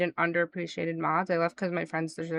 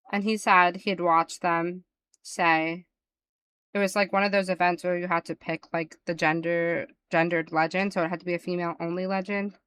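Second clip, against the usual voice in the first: ordinary talk, nothing marked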